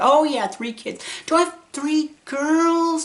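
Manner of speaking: lightly voiced puzzled mother; imitating confused mom. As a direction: puzzle over the children.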